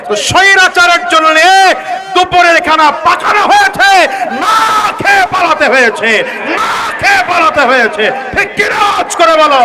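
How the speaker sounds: loud, high preaching shout; preacher at full force; fiery preaching. Real, not acted. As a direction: hammer it home to the crowd.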